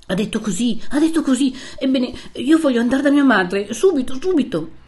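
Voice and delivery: voicing a frantic captive's plea; agitated insistence. A narrator's reading. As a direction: insist urgently, repeating in rising distress. Captive insists on going to mother.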